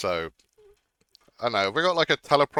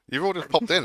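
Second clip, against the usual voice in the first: laughing through the words; 7 semitones higher than usual. Real, not acted. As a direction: giggle while saying it, higher. Repeats greeting laughing.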